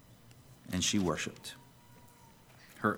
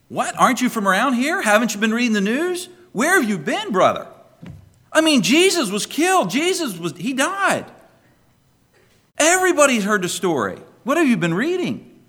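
high, loud, disbelieving disciple voice; a man amazed anyone missed the news; incredulous disciple; 12 semitones higher, 9 dB louder than usual. Acted. Disciple to the stranger on the road, high incredulous voice.

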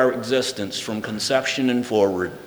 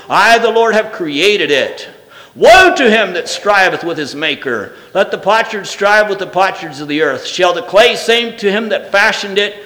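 raised, ringing reading with the Lord's lines pushed louder; scripture reading; 6 semitones higher, 5 dB louder than usual. Acted. Preacher reads Isaiah forty-five to the church, ringing reading voice. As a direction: proclaim the verses as the voice of God.

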